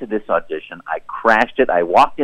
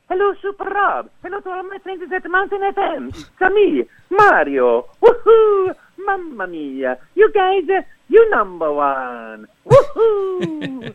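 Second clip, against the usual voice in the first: high, bright Italian plumber cheer; Mario voice; slower than usual. Pretend. Greeting the radio host as Mario, high and playful. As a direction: bounce it out like Mario himself. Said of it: "Charles Martinet joining me".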